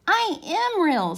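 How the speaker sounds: high, slow insistent little voice; little rabbit insisting. A narrator's reading. Toy rabbit insisting to the furry rabbits, high and slow. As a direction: insist slowly in a small high voice. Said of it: "said the little rabbit".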